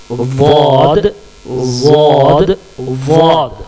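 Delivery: वाद drawn out slowly from the side of the tongue; slow letter demo. Shown as a demonstration. Teacher slowly modelling the letter to the class. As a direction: press the tongue edge to the gums for वाद. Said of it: "वाद का जो मखरज है".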